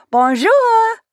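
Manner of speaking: high, loud hammed-up French Bonjour; loud fake French. A narrator's reading. Waitress greeting a stranger loudly in fake French. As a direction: belt out a cheesy French Bonjour. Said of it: "I say loud enough for Sam to hear".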